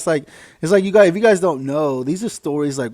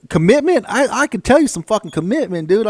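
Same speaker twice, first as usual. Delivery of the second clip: scoffing retort; 7 semitones higher, 4 dB louder than usual. Retold or imitated. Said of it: "I say".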